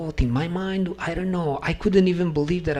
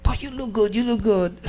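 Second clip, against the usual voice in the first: higher, louder teasing friend; 8 semitones higher, 4 dB louder than usual. Retold or imitated. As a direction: needle him playfully. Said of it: "I said".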